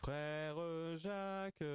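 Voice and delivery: normal talking voice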